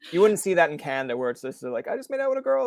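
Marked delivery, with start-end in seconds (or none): 1.9-2.7 high-pitched, hammed-up glee; mock giddy announcement. Excited man telling a Canadian stranger, mock giddy.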